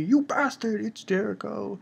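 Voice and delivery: high, strained furious yell; angry shout. Retold or imitated. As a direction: spit out the insult. Retelling an angry manga quote.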